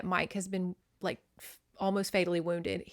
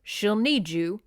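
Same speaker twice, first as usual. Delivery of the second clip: reading a novel passage aloud with character voices; story narration; 4 dB louder than usual. Acted. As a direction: read it like a dramatic novel. Reads novel excerpt aloud.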